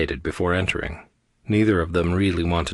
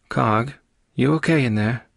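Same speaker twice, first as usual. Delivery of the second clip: higher-pitched concerned call; lifted, questioning call; calling through a door; 5 semitones higher than usual. A narrator's reading. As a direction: check on someone through a closed door.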